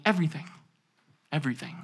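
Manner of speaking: very soft loving repeat; tender whisper-soft. Retold or imitated. Father and son softly trading their word. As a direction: murmur it gently, twice.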